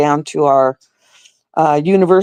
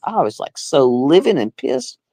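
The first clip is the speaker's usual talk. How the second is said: ordinary talk, nothing marked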